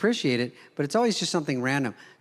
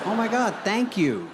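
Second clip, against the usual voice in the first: higher-pitched polite mock thanks; feigned gratitude; 7 semitones higher than usual. Comic thanking brother for a bloodied knife, feigned gratitude. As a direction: put on a touch of delight.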